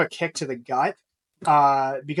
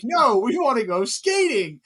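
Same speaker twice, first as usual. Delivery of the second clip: put-on kids' voice; 8 semitones higher, 5 dB louder, slower than usual. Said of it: "all the kids are like".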